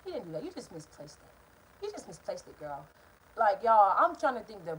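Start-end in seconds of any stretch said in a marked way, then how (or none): 0.0-2.9 low, soft inner voice, dropping away; hushed retold thought to oneself; quiet self-reassurance. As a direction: talk yourself down under your breath. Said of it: "And I'm thinking".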